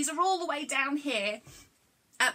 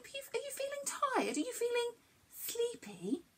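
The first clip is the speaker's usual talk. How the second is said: much softer and higher, tender; soft sleepy coaxing; 9 semitones higher, 7 dB quieter than usual. Pretend. Presenter gently asking young viewers if they feel sleepy, soft. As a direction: ask as if tucking a child in.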